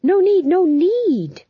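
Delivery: high, loud, drawn-out plea; desperate. A narrator's reading. Fool tries to head off executions, desperate. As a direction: plead urgently, pitched right up. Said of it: "said the fool desperately".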